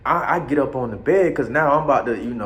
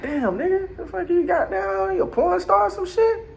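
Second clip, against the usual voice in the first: amazed. Retold or imitated. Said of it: "I'm like".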